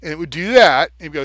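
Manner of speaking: ordinary talk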